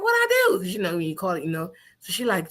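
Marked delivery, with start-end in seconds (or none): 0.0-0.6 very high, innocent what I do; accused by her friend, acting innocent; indignant surprise. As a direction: play dumb, squeaky. Accused of being drunk.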